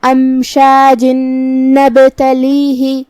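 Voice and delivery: امشاج نبتلیہ chanted slowly, tanween merged into a long hummed n; ghunna and shaddah. Shown as a demonstration. Teacher showing the merge into nun, slow nasal chant. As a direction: draw out the doubled nasal n joining امشاج to نبتلیہ. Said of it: "تنوین کو نون میں ملا کے غنہ اور تشدید".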